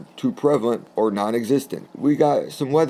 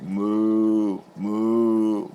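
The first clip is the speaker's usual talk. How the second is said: slow, drawn-out mock moos; imitated cow moo. Describing cows around the tent, slow mock mooing. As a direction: stretch out a lazy cow moo.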